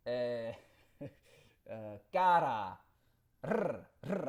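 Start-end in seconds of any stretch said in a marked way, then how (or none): 2.1-4.3 "cara", then long trilled "Rrr, rrr"; rolled R. Shown as a demonstration. Proving he's Cuban, trilling "Rrr, rrr" after "cara". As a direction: draw out the tongue trill in "Rrr, rrr". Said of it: "I can do the rolling R".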